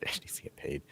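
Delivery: hushed, unvoiced echo of the demand; whispered echo. Co-host echoing the money joke, whispered. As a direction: whisper it back like a secret. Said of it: "echoed in a whisper".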